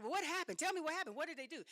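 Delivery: high, anxious pleading questions; high-pitched, anxious asking; worried questions. Retold or imitated. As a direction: press him for answers, worried. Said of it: "So I was like".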